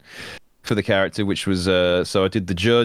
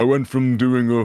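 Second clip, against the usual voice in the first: first zealot voice; 3 semitones higher than usual. Shown as a demonstration. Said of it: "a voice like this".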